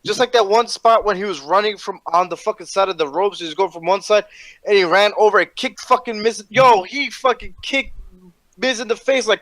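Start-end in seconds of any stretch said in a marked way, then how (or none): none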